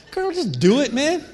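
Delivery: exasperated dad. Acted. Father telling his daughter to just do it, playfully exasperated. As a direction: brush off the question with a fond sigh.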